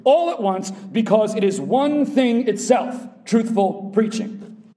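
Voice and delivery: loud, high, driving sermon delivery; full-force preaching. Acted. Preacher thundering to the congregation, full force. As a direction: preach it with full force, pounding each quality.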